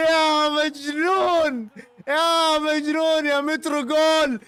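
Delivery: slow, stretched celebration cry; like a commentator hailing the goal; drawn-out goal call. Real, not acted. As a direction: drag out every word in triumph.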